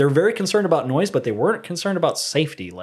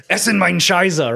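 mock German; 4 semitones higher, slower than usual. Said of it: "walk up to a German person on the street and go".

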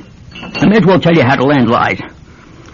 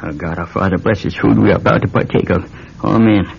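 saying grace; 6 semitones lower, 4 dB quieter than usual. Acted. Father says grace at supper.

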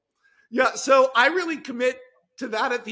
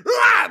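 mock scream; 11 dB louder than usual. Werewolf roar or scream.

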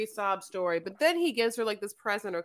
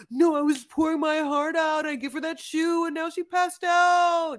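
higher, louder hammed-up huff of a jilted guy; imitating a sulking contestant complaining to his bros; mock indignant rant; 7 semitones higher, 4 dB louder than usual. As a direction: whine it like a wounded bro, half shouting. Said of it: "And he's like".

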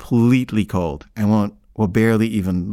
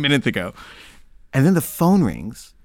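ordinary delivery

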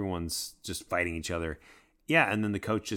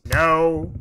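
sharp, loud high no; 8 semitones higher, 12 dB louder than usual. Real, not acted. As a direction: shout the no down. Denying booming voice comparison.

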